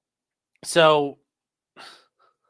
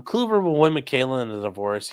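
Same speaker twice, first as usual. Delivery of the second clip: normal talking voice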